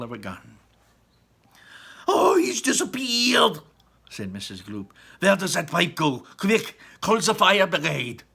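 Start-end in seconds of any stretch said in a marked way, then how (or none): 2.1-3.6 high, loud crying wail; loud high slow wail of fear; panicked cry. Acted. Mother sees son vanish. 5.2-8.2 loud, higher urgent plea; loud high frantic call, slowing at the end; panicked mother. Acted.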